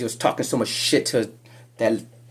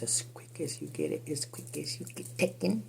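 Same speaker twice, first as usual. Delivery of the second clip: normal talking voice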